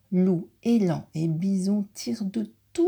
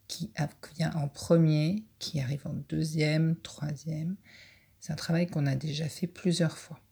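usual speaking voice

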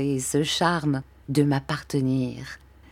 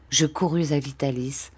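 ordinary delivery, nothing marked

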